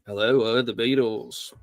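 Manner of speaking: badly faked Liverpool accent; mock Scouse, deliberately off. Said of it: "That's a bad accent".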